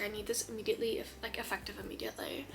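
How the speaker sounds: hushed, eager whisper; urgent whisper. Retold or imitated. Ordering the book, eager hushed whisper. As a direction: breathe it like a secret need. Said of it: "I was like".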